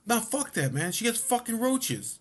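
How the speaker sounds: very high, indignant protest at normal volume; imitated freak-out. Friend protesting the roaches to his buddy, mock freak-out. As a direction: mimic a panicked friend, pitch way up. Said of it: "he's gonna freak out".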